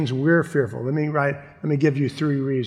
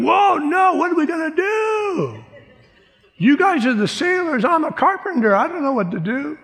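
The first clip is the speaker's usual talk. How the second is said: panicky Jesus; 13 semitones higher than usual. Pretend. Pastor jokingly voicing Jesus as a clueless carpenter, high mock panic. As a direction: ham up a flustered carpenter out of his depth. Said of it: "And he's like".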